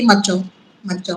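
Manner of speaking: low, hushed voice in the ear; imitated male voice. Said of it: "constantly मेल voice मेरे कान में बोलती". (धीमी, नीची मर्दाना आवाज़ की नकल)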